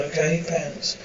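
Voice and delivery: normal talking voice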